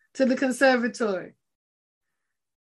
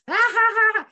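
hammed-up cackle, higher and louder; mock gloating laugh; 5 semitones higher, 5 dB louder, faster than usual. Preacher mocks the gloaters with a hammed-up laugh. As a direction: laugh at their downfall. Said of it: "saying and screaming".

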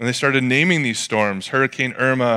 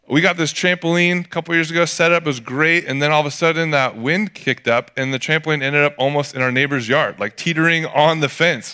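ordinary talk, nothing marked

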